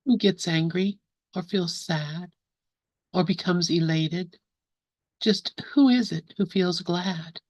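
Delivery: slow, measured recital with long pauses between lines; slow poem reading. Real, not acted. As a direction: read each line slowly, leave room to contemplate. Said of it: "gonna read the poem slowly".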